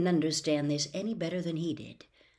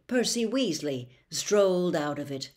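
ordinary delivery

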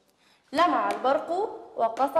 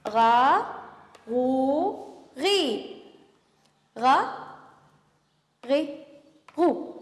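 غو غي stretched out very slowly; very slow drawn sounds; much slower than usual. Shown as a demonstration. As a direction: hold each syllable long for the class. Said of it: "سنعيد الآن". (نطق بطيء جدًا)

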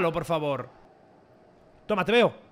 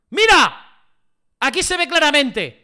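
loud, high exclamation of proof; emphatic shout; 6 semitones higher, 8 dB louder than usual. Real, not acted. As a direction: shout to make them look. Protesting over replay.

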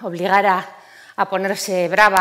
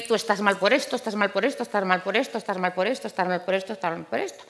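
mimicked family litany; 7 dB quieter, faster than usual. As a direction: rattle off relatives' repeated diagnoses, getting quieter. Relatives' repeated reproaches, acted out.

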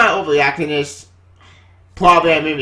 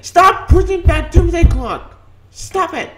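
pleading yell, higher and louder; higher exasperated plea, drawn out; frustrated outburst; 11 semitones higher, 3 dB louder, slower than usual. Real, not acted. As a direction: beg them to stop, exasperated. Ranting at publisher.

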